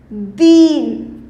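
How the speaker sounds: दीन, ई को खींचकर ज़ोर से; long ee, stressed. Shown as a demonstration. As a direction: hold the ee in दीन long and firm. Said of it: "उसको थोड़ा सा ज़ोर की बोलेंगे".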